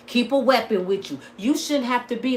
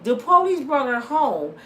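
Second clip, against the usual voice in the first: ordinary delivery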